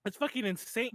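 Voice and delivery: ordinary talk, nothing marked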